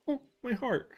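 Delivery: high, touched swoon; mock swoon. Streamer retelling the worker's thanks, swooning high. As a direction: play it as heart-melting delight.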